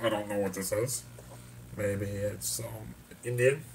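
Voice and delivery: warped voice effect testing an accent; strange accent filter. Shown as a demonstration. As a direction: voice run through an odd accent filter. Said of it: "I could also have a strange accent".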